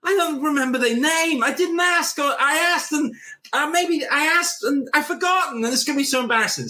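flustered inner panic. Retold or imitated. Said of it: "you're like".

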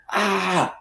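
high mock scream. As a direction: replay the terrified scream high but contained. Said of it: "I screamed this blood-curdling scream".

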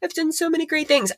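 high, fast, showy boasting voice; mock bragging. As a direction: parody a self-satisfied brag. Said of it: "to be like".

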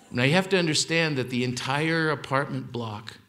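ordinary talk

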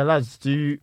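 ordinary delivery

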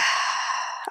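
long breathy sigh; heavy sigh. Real, not acted. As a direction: let out a big sigh, holding excitement back. Struggling to contain excitement.

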